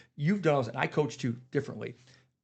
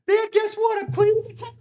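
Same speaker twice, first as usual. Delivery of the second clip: high mocking kid voice, much higher and louder; mock the son in a squeaky child voice; imitating his son, chirpy and high; mimicking son; 9 dB louder than usual. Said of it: "Then he'll come up and says".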